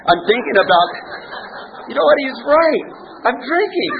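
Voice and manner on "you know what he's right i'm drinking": high, laughing at himself; rueful chuckle through it; laughing admission. Real, not acted. Ironic admission while laughing.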